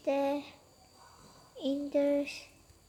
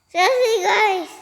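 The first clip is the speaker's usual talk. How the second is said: exasperated; 6 semitones higher, 10 dB louder than usual. Real, not acted. Exasperated complaint to viewers.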